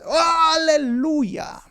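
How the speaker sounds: a long, high, drawn-out hallelujah; joyful cry. Pretend. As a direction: cry out in relief and joy. Imagined reaction to the cure. (exclamación de júbilo, aguda y alargada)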